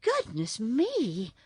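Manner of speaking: mildly frightened, slow exclamation; understated alarm; trembling a little. A narrator's reading. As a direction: draw it out with a touch of fear. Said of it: "trembling a little".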